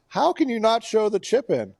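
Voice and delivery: high-pitched, exasperated disbelief; indignant complaint. Real, not acted. Golf fan ranting at the channel's coverage, high indignant protest. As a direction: protest it as an outrage.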